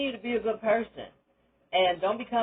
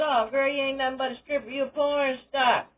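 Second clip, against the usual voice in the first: higher, sneering put-down; mocking gossip; 6 semitones higher than usual. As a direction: scoff at her dream, pitched up. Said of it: "They said".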